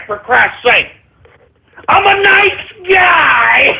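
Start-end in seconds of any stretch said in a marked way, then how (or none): 1.9-3.7 high, slow, drawn-out cry; stretched-out pleading holler; wailing protest. Real, not acted. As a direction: wail it in mock indignation. Loudly protesting he is friendly.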